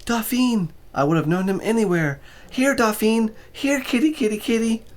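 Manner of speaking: high, sing-song calling; young woman calling a kitty; calling the cat. A narrator's reading.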